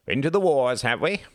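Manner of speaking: loud, high hearty coach bark; gruff rugby coach. A narrator's reading. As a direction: play the bluff games master. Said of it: "imitated a gruff rugby coach".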